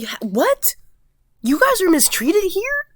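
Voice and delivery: high squeaky astonished question; shocked disbelief. Retold or imitated. Imitating the girl hearing about mistreatment, high shocked disbelief. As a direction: play her stunned surprise. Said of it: "She was very shocked".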